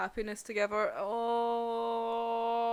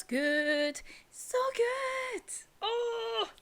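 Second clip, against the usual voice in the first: high excited praise; gushing; 12 semitones higher, much slower than usual. Real, not acted. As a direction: gush over it. Gushing over chapter.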